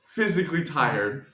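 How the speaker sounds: normal talking voice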